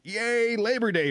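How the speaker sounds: a playful, lifted cheer; retold cheer. Customer chiming in on coffee shop talk about Labor Day, playful cheer.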